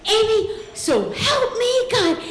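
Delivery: slow, high mock warning from the sister; sister's warning. Big sister on the phone, slow high mock threat. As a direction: drag it out like a big sister about to blow. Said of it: "And she goes".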